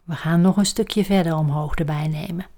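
ordinary talk, nothing marked

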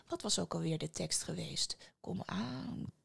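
whispered, searching his memory; whisper to himself. Acted. Antoine whispers, trying to recall the magic words. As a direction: whisper it under your breath.

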